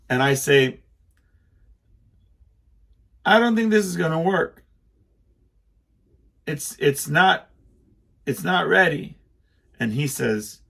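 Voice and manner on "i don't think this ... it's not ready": higher, louder call over engine noise; worried warning shouted to son at chainsaw; shouting over a chainsaw. Retold or imitated. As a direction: raise your voice to be heard over the saw.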